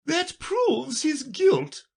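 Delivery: slow, piercingly high verdict; very high, drawn-out royal voice; condemning queen. Acted. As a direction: declare guilt as a storybook queen. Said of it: "said the queen".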